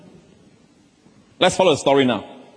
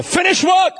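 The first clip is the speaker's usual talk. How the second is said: exultant proclamation. Real, not acted. Preacher proclaiming finished work.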